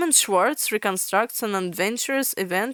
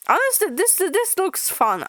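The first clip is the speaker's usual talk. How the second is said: delighted; 9 semitones higher than usual. Real, not acted. Excited reaction to comic.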